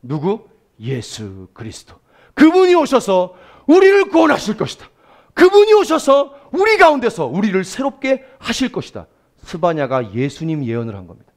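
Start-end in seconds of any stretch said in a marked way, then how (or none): 0.8-1.9 reverent hush. Acted. Answer to rhetorical 누구. 2.3-9.1 preaching at full force. Acted. Prophetic proclamation.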